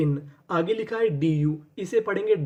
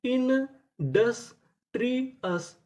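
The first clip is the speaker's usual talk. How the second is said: very slow, each syllable stretched, industrious drawn out and higher; slow drawn-out reveal; 5 semitones higher, much slower than usual. Shown as a demonstration. Teacher showing students how to read the word, very slow and drawn out. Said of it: "तो हमारी पूरी meaning क्या बनी?".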